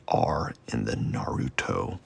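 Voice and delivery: quiet, slow "Naruto" with the R drawn out; emphasized R. Shown as a demonstration. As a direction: softly stretch the R in "Naruto". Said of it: "Now I gotta emphasize that".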